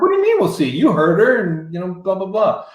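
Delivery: high-pitched mock protest; indignant disbelief. Musician objecting to the producer's doubt, indignant.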